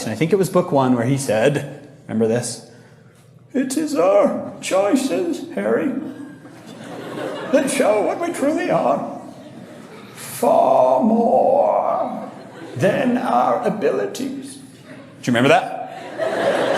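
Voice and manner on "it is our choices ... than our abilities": put-on high, slow, drawn-out wise old wizard; Dumbledore impression. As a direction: stretch every word like a grave old mentor. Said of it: "he always draws out his words".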